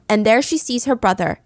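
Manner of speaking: ordinary delivery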